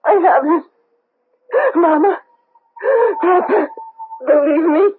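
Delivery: high, tearful insistence; desperate crying. Acted. Daughter in a nightmare, crying to her parents that she hasn't forsaken them. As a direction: cry it out, begging to be believed.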